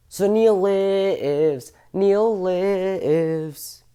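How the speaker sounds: very slow, stretched-out vowels; slow, elongated proclamation; drawn-out declaration. Pretend. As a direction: hold "lives" long, like a chant. Mock-triumphant drawn-out declaration.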